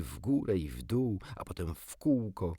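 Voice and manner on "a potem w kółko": usual speaking voice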